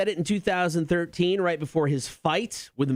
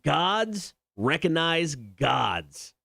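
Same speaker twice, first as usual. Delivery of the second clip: slow dramatic quote; much slower than usual. Acted. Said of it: "Conor McGregor".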